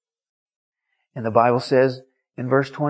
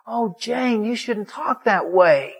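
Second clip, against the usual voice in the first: acted-out pleading reproach, higher pitched; gentle reproach; 9 semitones higher, slower than usual. Preacher reproaches angry Jane, pleading. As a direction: plead with her in a high, pained voice.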